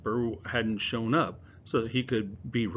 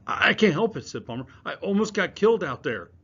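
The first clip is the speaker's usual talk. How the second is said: agitated; 8 semitones higher, 7 dB louder than usual. Acted. Jumpy boy pacing.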